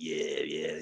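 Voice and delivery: low, soft mock creep; creepy customer. Imitating a creepy sock collector, low quiet mock voice. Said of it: "He's like".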